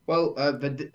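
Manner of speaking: ordinary talk, nothing marked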